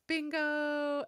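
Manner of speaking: high, loud cartoon call; calling out Bingo. Mom imitating the Bluey opening, calling Bingo high and loud. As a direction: shout Bingo bright and high. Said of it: "the next one's like".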